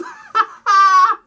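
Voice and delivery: high, loud burst of laughter; genuinely cracking up; real laugh. Real, not acted. Speaker cracks up.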